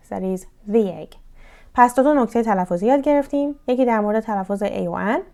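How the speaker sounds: normal talking voice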